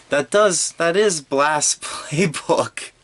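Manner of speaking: laughing through the words; can't hold back the giggle. Real, not acted. Laughing at Blast's naked habit.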